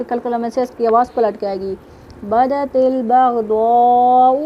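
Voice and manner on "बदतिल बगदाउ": slow recitation demo. Shown as a demonstration. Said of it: "इनको जल्दी से पढ़ा जा रहा है".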